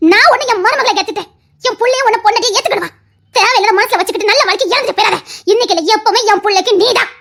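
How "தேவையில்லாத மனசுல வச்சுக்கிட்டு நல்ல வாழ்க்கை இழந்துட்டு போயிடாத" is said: normal talking voice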